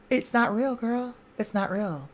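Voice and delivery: ordinary talk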